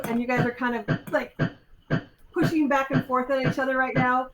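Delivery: ordinary talk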